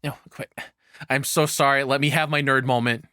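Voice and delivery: ordinary talk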